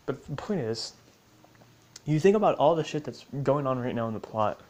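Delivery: normal talking voice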